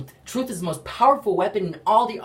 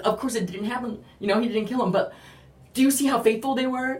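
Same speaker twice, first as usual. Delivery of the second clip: ordinary delivery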